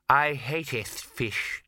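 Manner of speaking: slow, drawling, haughty cat; sniffy, very slow cat voice; snooty bored cat. Acted.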